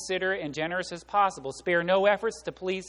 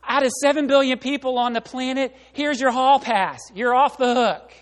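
mock breezy pass; 6 semitones higher, 6 dB louder than usual. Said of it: "God doesn't say".

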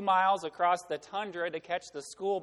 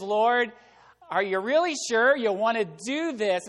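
louder, higher, disbelieving question; incredulous plea; 4 semitones higher, 7 dB louder than usual. Acted. Habakkuk asks God a second question, incredulous. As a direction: press God in open disbelief.

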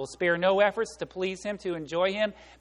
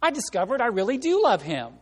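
exclaiming; 5 semitones higher, 3 dB louder than usual. Retold or imitated. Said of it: "she exclaimed".

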